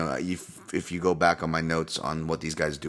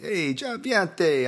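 hype announcer call; 9 semitones higher, slower than usual. Acted. Hyping fighter like an announcer.

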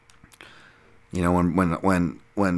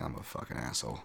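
muttered self-reproach; 6 semitones lower, 16 dB quieter than usual. Retold or imitated. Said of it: "I'm like".

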